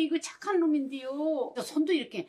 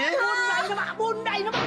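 high, sharp scold; scolding mother; 8 semitones higher than usual. Acted. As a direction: shriek at a useless son. (못난 아들을 높은 소리로 꾸짖는 엄마)

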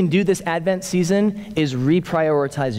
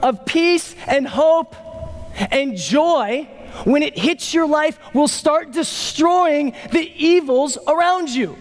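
raised, high-pitched sermon climax; forceful preaching; 10 semitones higher than usual. Real, not acted. Preacher declaring the perfect storm to congregation, forceful. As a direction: drive it home with rising intensity.

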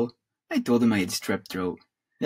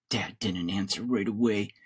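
usual speaking voice

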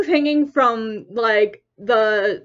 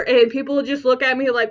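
ordinary talk

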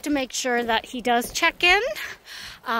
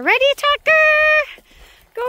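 very high, loud call; squeaky dog voice; 12 semitones higher, 7 dB louder than usual. Real, not acted. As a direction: get the dog excited to run. About to throw the ball.

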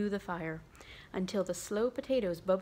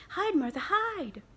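high, loud urgent cry; excited children; 10 semitones higher, 5 dB louder than usual. Acted. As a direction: hiss the warning gleefully. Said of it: "cried the two young Cratchits".

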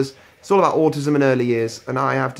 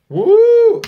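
loud high whoop of joy; excited whoop; 11 dB louder than usual. Real, not acted. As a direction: let out a happy cheer. Cheers arriving home.

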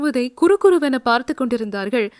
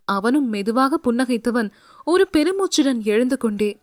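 ordinary delivery

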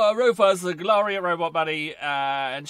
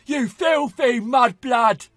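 slow, loud, higher sneering shout; Bellatrix shout; 5 semitones higher, 8 dB louder, much slower than usual. Retold or imitated. Gamer quotes Bellatrix from the films, slow sneering shout. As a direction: scream the insult slowly like a mad witch. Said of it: "Bellatrix makes me chuckle".

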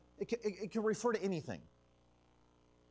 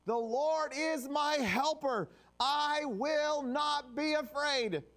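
slow, raised and louder, each word planted; confident proclamation; 5 semitones higher, 3 dB louder, much slower than usual. Acted. Pastor proclaiming the verse to his congregation, slow and bold. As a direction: declare it like a promise you trust. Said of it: "we can confidently say".

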